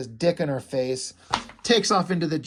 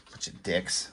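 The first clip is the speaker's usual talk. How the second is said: breathy under-the-breath insult; a whispered jab at the comic company; whispered aside; 9 semitones lower, 10 dB quieter than usual. Real, not acted. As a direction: mutter it almost silently to yourself. Muttered insult at the publisher.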